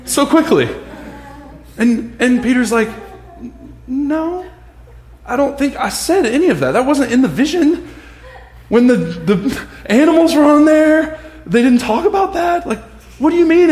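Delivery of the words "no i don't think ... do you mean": high, loud baffled protest; hammed-up bewildered Peter; incredulous Peter. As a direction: push back, bewildered.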